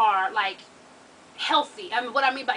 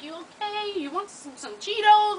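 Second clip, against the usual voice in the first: concerned paramedic; 8 semitones higher, slower than usual. Retold or imitated. Paramedic trying to calm the pissed mother, coaxing. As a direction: gently offer it in a lifted voice. Paramedic gently offering a snack.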